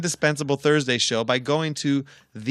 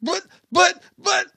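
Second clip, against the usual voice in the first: very high, loud mimicked squeal; mock a kid sputtering in squeaky protest; imitated child's shrill stammering objection; 6 dB louder than usual. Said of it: "And he goes".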